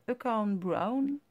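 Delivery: slowly sounded-out English in a French mouth; mock-proud English accent. Shown as a demonstration. Vlogger naming an ink colour, self-mocking English accent. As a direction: attempt an English accent, drawing it out. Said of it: "avec mon super accent anglais".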